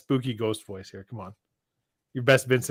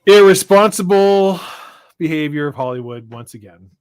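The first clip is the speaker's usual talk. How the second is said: mock outrage; 3 semitones higher, 11 dB louder than usual.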